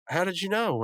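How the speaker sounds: high-pitched, amazed retold question; astonished question. Imposter asking how he was found out, astonished. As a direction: act out a caught-out character's surprise.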